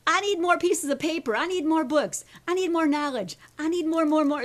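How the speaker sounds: loud, high, mock-panicked demands; frantic self-talk. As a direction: act out an overwhelmed inner voice. Acting out her anxious self-talk.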